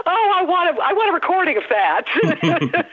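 laughing. Real, not acted. Laughing at host's joke.

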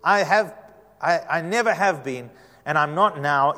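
loud, halting plea to the Lord; pleading. Acted. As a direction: plead insistently, stumbling over the words. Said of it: "Moses pleaded with the Lord".

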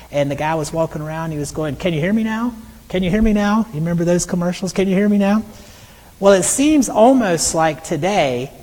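usual speaking voice

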